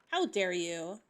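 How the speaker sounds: normal talking voice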